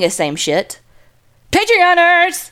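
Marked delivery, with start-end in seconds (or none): 1.5-2.5 Patreoners! called out high; excited shout-out. Real, not acted. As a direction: hype up the Patreoners. Host's pet name for patrons.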